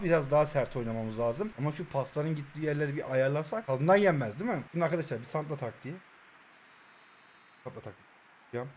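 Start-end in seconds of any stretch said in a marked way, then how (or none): 4.7-8.7 low voice fading to very soft; hushed announcement. Real, not acted. Player tells viewers of his next tactic, hushed. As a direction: let the words sink almost to nothing.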